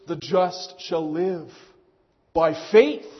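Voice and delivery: faith lifted and loud with a rising tune; stress on faith. Shown as a demonstration. Preacher to congregation, stressing faith. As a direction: lean hard into the word faith. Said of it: "that emphasis on faith".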